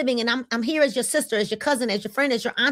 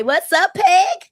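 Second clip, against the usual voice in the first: higher and louder, bright call-out; excited shout-out; 6 semitones higher, 7 dB louder, slower than usual. Real, not acted. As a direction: call out gladly to a friend. Greeting a viewer by name.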